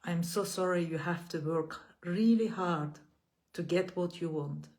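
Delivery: measured, gentle affirmations with long pauses between. Acted. Leads repeat-after-me affirmations.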